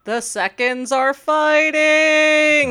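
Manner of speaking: drawn-out gleeful exclamation; excited. Real, not acted. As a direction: savour it like a delighted fan. Gleeful fan moment about rivals.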